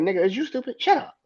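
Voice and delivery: hammed-up disbelief at a dumb remark; incredulous mock outburst. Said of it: "be like".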